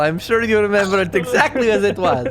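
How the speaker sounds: high, coaxing toymaker reassurance; eccentric toymaker. Acted. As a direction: soothe them, theatrical and high. GM voices toymaker.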